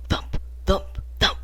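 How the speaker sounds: breathy, hushed heartbeat thumps; imitated heartbeat. A narrator's reading. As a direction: thump softly like a pounding heart, spaced out. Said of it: "almost hear it in my chest".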